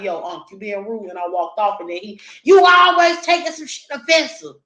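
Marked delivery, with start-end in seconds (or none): 0.0-1.1 low, soft reproach to uncle; calm low rebuke, barely raised; quiet call-out. Retold or imitated. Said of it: "I was like". 2.4-4.6 loud, higher angry retort; an irritated uncle yelling after him; uncle shouting back. Retold or imitated.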